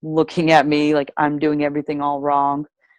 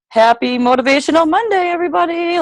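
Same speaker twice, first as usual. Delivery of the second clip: mock cheer; 13 semitones higher, 3 dB louder than usual. Mocking her upbeat post.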